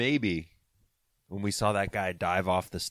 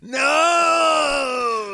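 mock anguished cry, high; anguished shout; 7 dB louder than usual. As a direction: wail it like a heartbroken fan. Jazz about to be eliminated.

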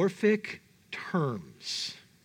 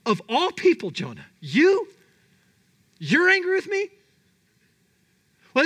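raised, emphatic scolding in an incredulous voice; God's indignant rebuke; 3 semitones higher than usual. Acted. God confronting Jonah over his rebellion, indignant. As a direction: hammer each accusation, punching "you".

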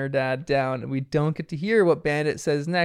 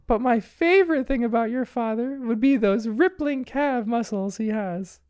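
high put-on mom voice; dad imitating mom; 7 semitones higher than usual. Bandit poses as Mom to the girls, mock feminine. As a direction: play a dad hammily mimicking his wife. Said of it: "acting like Chili's".

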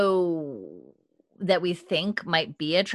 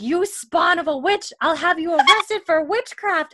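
mock furious shriek; 10 semitones higher, 4 dB louder than usual. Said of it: "Leary's like".

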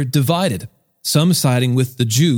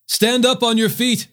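high-pitched, mildly raised command; loud command; 7 semitones higher than usual. A narrator's reading. Paul to the lame man, mildly raised loud voice. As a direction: call out the order without real shouting. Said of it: "Paul said in a loud voice".

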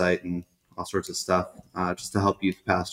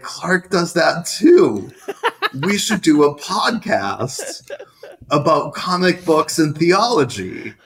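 slow, high, laughing through it; giddy retold idea; laughing excitedly; 8 semitones higher, 5 dB louder, slower than usual.

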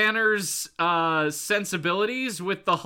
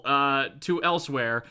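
usual speaking voice

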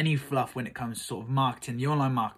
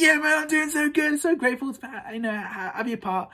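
much higher, eager and breathless thanks; starstruck gush; 11 semitones higher than usual. Retold or imitated. Thanking the guru for joining, high starstruck gush. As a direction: trip over yourself with gratitude. Retelling his own starstruck reply.

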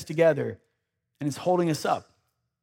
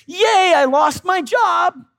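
mock delight, much higher and louder; fake cheerful; 10 semitones higher, 4 dB louder than usual. Preacher mocking a fake-happy believer, hammed-up cheer. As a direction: shout out sarcastic joy over bad news. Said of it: "act like you're happy about them".

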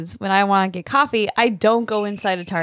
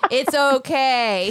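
slow soothing; 3 semitones higher, much slower than usual. Retold or imitated. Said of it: "I'm like".

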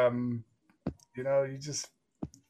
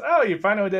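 raised, louder knowing greeting; npc greeting; 7 semitones higher, 6 dB louder, faster than usual. Acted. NPC greets the player who finally came, knowing tone. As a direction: greet them like you expected them.

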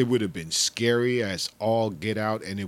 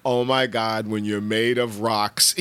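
usual speaking voice

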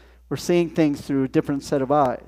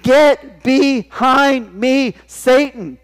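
loud, higher, very slow command; stern rebuke; 9 semitones higher, 6 dB louder, much slower than usual. Acted. Jesus rebuking Peter, loud and very slow. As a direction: drag out the rebuke with force. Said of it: "Jesus turned and he said".

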